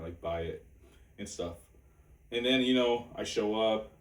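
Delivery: ordinary delivery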